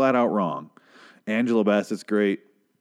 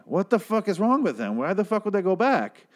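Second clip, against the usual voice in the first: normal talking voice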